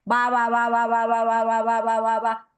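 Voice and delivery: BA chained on one held note with loose lips; repeated baba. Shown as a demonstration. Teacher repeats baba many times, chained fricative b. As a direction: rattle off BA nonstop, b never fully closing. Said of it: "¿Cómo pronunciaría estas consonantes?".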